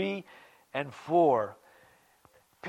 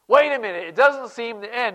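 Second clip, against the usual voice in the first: mock objection; 7 semitones higher, 7 dB louder than usual. Voices a suffering believer's objection.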